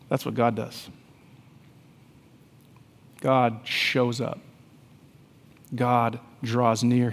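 low, soft, tender preacher's voice; hushed reflective preaching. Acted. Father recalls his sleeping daughter, then God, hushed preaching. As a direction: let it settle into quiet reverence, with long pauses.

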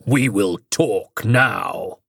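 very slow, low, growling alien voice. Acted. Possessing entity speaks through Charlie to the Prime Minister, slow menacing growl. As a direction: let something else speak through you, slow and low. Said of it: "a growling edge to it and a much deeper register".

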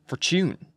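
"Fortune" lifted in pitch like a question; questioning upturn. A narrator's reading. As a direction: say "Fortune" as if asking. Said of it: "His voice went up at the end of every sentence".

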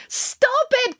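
very high, desperate cry. A narrator's reading. Finn begs April to stop kicking, crying out. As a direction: cry out in high-pitched alarm. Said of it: "cried Finn".

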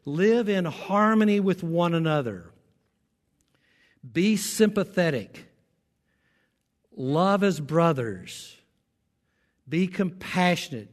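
deliberate, preacherly Bible reading; scripture read aloud. Real, not acted. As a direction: let each command land separately. Reads out scripture commands to congregation.

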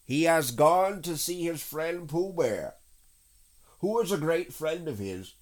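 high, slow put-on rabbit voice; high little creature voice; evasive rabbit. A narrator's reading. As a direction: explain it away primly.

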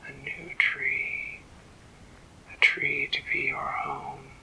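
an ancient tree speaking inside her head; eerie tree whisper. A narrator's reading. The old tree calls the fairy by her new name, whispering.

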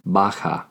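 "baja", an H sound where the J is written. Shown as a demonstration. Teacher giving the female form, "baja" said with an H for J. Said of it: "the J makes an H sound".

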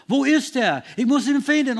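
anxious father hunting through the crowd; frantic search. Retold or imitated. Jairus searching the crowd for Jesus, frantic and loud.

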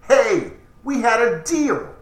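a hint of sudden alarm at being caught; mock panic as the deal falls apart; freaking out. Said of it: "Johnny freaks out".

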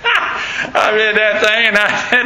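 talking through a real laugh; words breaking up with laughter. Real, not acted.

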